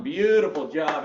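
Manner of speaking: normal talking voice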